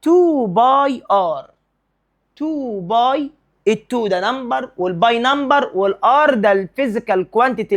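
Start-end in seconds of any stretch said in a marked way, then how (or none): 0.0-1.5 تو باي ار said slowly, a bit higher, each word stressed; slow emphasis. Shown as a demonstration. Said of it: "لكن السيركمفرنس". (تو باي ار ببطء وتشديد كل كلمة)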